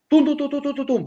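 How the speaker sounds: high mouth-made dial beeps; imitated phone tones. Imitating the phone dialing, high mock beeps. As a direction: tap out the ringing phone with your voice.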